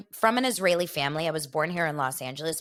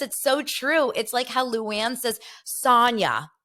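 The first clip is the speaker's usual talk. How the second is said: normal talking voice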